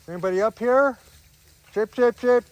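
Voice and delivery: high, loud call up the hill. Real, not acted. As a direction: hail the sheep from a distance. Calling for sheep uphill.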